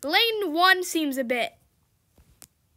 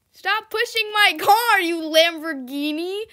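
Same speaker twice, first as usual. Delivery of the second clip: angry yell; 3 semitones higher, 5 dB louder than usual. Real, not acted. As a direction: tell the other driver off. Yelling at a car pushing her.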